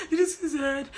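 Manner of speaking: high, loud, half-crying question; crying disbelief. Retold or imitated. Recapping the fight for viewers, mock wailing. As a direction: wail it like you can't believe it. Reenacting the housemates' reaction.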